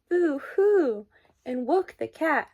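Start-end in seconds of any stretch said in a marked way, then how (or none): 0.1-1.1 higher, louder boo-hoo; mock crying boo-hoo. A narrator's reading. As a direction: cry it like a scared rat. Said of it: "who cried".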